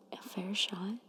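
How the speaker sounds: ordinary talk, nothing marked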